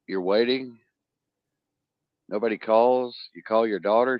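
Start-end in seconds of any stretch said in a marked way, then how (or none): none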